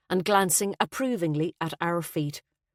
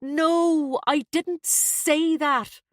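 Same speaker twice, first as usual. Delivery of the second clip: drawn-out, high exasperated denial; worn-out son's exasperated protest; weary, exasperated; 10 semitones higher, 3 dB louder, much slower than usual. A narrator's reading. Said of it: "Ronan sounded weary and exasperated".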